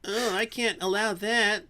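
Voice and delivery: lampoon him as a stiff objector; hammed-up impression of a friend banning religion; mocking his friend. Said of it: "and he's like".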